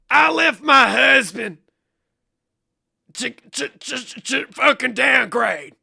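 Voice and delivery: slow, high, shouted caricature; hammed-up full-volume impression; mocking imitation. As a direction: yell it drawn out, with a stammer midway. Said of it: "let me do it again".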